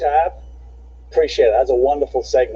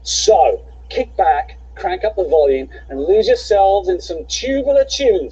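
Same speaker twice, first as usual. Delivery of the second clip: script read; 4 semitones higher than usual. Acted. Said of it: "the only time I've ever read the script".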